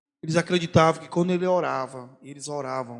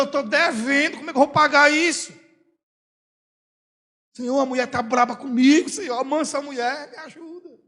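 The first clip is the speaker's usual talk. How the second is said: desperate prayer; 9 semitones higher, 5 dB louder than usual. Retold or imitated. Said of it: "Eu chego e digo".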